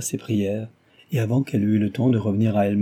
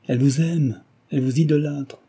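fervent, higher-pitched insistence; heated exclamation; 5 semitones higher, 4 dB louder than usual. A narrator's reading. Suitor assures Cécile of his mother's love, with fervour. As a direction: press it on her with warmth and heat. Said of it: "s'écria-t-il avec chaleur".

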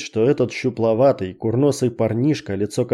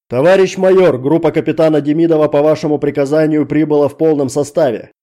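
loud, higher formal military report; booming report; 5 semitones higher, 6 dB louder than usual. Acted. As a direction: report to the major at full voice. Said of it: "громогласно доложил". (громогласный доклад)

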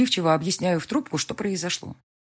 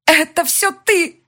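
higher, louder, slow raging scream; furious scream; 13 semitones higher, 10 dB louder, much slower than usual. A narrator's reading. Elvira screaming accusation at the heroine, furious. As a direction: attack her with the words.

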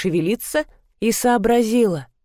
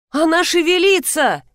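high-pitched startled cry; mild, high yell; shouting; 8 semitones higher than usual. A narrator's reading. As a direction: cry out in alarm, pitched well up. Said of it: "закричала Нина".

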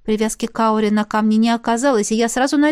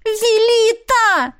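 much higher, long drawn-out howl; mental wail; 13 semitones higher, 4 dB louder, much slower than usual. A narrator's reading. The heroine desperately calls the gnome in her mind, howling. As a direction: howl the name in despair. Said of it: "Мысленно взвыла".

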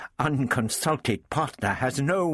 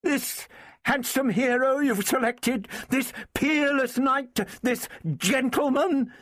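high, mocking tirade; high-pitched, scornful woman; furious sarcasm; 13 semitones higher than usual. A narrator's reading. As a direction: sneer through the list of his supposed charms. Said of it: "she cried furiously".

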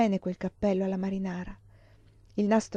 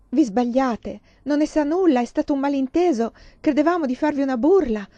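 high, loud, urgent explaining; flustered, raised-voice protest; agitated; 6 semitones higher, 5 dB louder than usual. A narrator's reading. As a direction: plead and explain in a rush of panic. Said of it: "continuò a parlare agitata ed incoerente".

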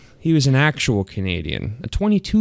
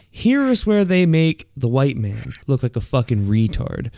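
normal talking voice

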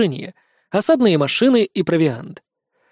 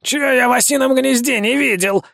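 higher, scared protest; recoiling vampire; 6 semitones higher than usual. A narrator's reading. Vampire balks at the mission, high and scared. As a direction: back away in fear as you say it. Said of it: "в страхе отшатнулся упырь".

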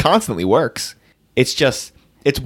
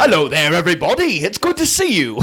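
bad Cockney accent, pitched up high; 4 semitones higher than usual. Podcaster mocking a famously bad movie accent, hammed-up Cockney. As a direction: do an American's hammed-up fake Cockney.

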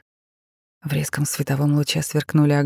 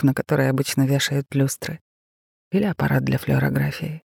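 usual speaking voice

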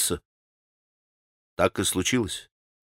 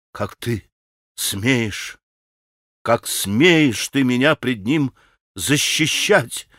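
understated, slow, halting indignation; wailing outrage; 2 semitones lower, slower than usual. A narrator's reading. Grushenka rebukes Mitya for defending her, mildly wailing. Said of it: "вопила Грушенька".

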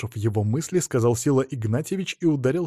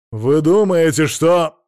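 loud, raised, indignant start; rising indignation; offended anger; 4 semitones higher, 8 dB louder, slower than usual. A narrator's reading. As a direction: begin an outraged retort and break off. Offended at being called drunk.

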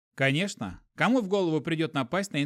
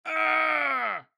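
scream; 12 semitones higher than usual. A narrator's reading. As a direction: shriek in panic. Inquisitor running, insects.

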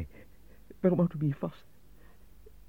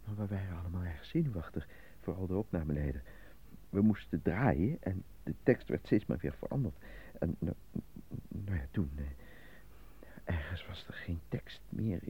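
subdued; 4 semitones lower, 8 dB quieter than usual. Real, not acted. Nervous man under interrogation.

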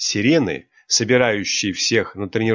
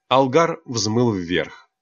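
plain reading voice

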